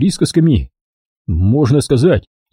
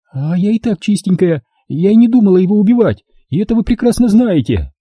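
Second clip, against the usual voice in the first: high-pitched self-defence; indignant protest; 7 semitones higher than usual. Acted. Dinka denies planning murder to the gang, protesting. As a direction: insist on innocence, voice pitched up.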